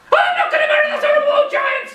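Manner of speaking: very high, loud shrieking refusal; Freya screaming. Acted. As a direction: scream it in outrage. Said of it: "Freya screaming her head off".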